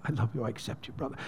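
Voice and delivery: hushed, low retold words of forgiveness; tender acceptance. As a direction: softly imitate people embracing a brother. Imitating congregation embracing the penitent.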